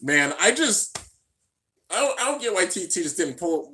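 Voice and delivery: normal talking voice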